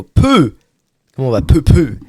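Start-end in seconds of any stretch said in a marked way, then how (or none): 0.0-0.6 silly exclaim. Acted. Silly noise. 1.4-2.0 silly exclaim. Acted. Silly noise.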